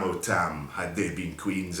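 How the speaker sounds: ordinary delivery, nothing marked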